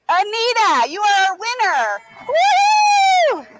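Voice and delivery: winner announcement shout. Real, not acted. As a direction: shout it out like a game show host.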